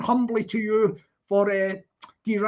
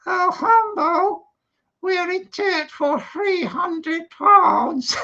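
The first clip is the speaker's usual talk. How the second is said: higher, slower squeaky dummy voice; the doll answers her partner, pitched up; 6 semitones higher, 4 dB louder, slower than usual. Acted. Ventriloquist dummy Amanda answering.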